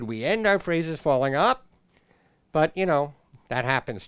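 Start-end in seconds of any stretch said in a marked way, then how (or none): 0.0-1.7 phrase lifting at "up"; falling up. Shown as a demonstration. Teacher showing a weird rising phrase ending. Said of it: "It would be weird if I said".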